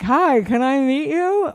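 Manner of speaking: high, slightly drawn-out, rising request; self-mocking wide-eyed hello; mock eager fan. Parent approaching a star, high eager greeting. Her simple approach to stars.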